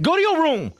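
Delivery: put-on high-pitched comic order to a child; mock parent scolding. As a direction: bust out a pretend parental reprimand.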